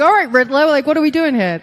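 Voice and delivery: loud, high, baffled retort; exasperated. Retold or imitated. Student answering a riddling trainer, loud and exasperated. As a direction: throw it out in mock exasperation.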